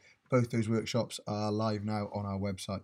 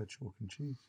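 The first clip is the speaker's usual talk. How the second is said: slightly resigned; 13 dB quieter than usual. Retold or imitated. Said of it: "slightly resigned voice".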